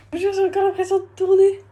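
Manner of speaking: high, very loud outburst; giddy shout to the viewers; dizzy exclamation. Real, not acted. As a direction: call it out high, still reeling. Still dizzy, calls out to viewers.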